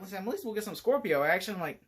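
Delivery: ordinary talk, nothing marked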